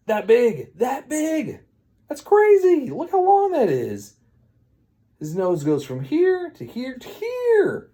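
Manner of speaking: high-pitched, incredulous amazement; astonished exclaiming. Acted. Storyteller reacting to the growing nose, high astonished exclaiming.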